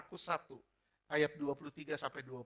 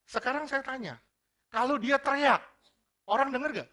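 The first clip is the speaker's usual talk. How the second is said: higher, louder sermon challenge; fiery preaching; 9 semitones higher, 9 dB louder than usual. Acted. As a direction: press the question on the congregation. Preaching at full force.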